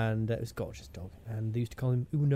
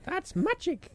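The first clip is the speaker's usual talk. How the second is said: high, drawn-out stage magician's catchphrase; catchphrase impression; slower than usual. Joking about a TV magician, high catchphrase impression. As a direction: squeal out a magician's catchphrase, very slowly. Paul Daniels catchphrase after the laugh.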